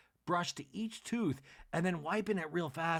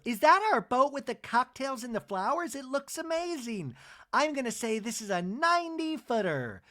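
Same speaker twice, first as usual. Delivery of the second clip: much higher, gushing voice; excited Jennifer; 9 semitones higher, 4 dB louder than usual. Retold or imitated. Jennifer spotting the yacht, high and gushing. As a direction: gush over the yacht. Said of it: "And Jennifer goes".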